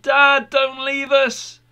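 put-on high child's voice calling out; pleading children. A narrator's reading. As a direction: beg a parent to stay in a high, childlike pitch. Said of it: "his little boy and his girl came out and they said".

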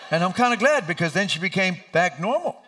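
ordinary talk, nothing marked

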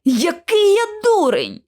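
slow, high-pitched exclamation; self-reproach. A narrator's reading. Lockwood realising what he overlooked, exclaiming in self-reproach. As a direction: cry out at your own stupidity.

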